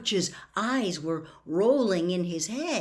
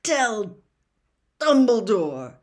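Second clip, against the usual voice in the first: halting, louder, each word dragged out; delirious man straining to speak; terrible effort; 4 semitones higher, 7 dB louder, slower than usual. A narrator's reading. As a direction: squeeze every word out. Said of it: "seemed to cost him a terrible effort".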